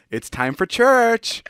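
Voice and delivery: high, slow taunting dad voice; teasing dad. Retold or imitated. Dad waking his son for church, slow and taunting. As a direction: drag it out to needle the kid. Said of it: "he'd say it in a way where he knew he was making me angry".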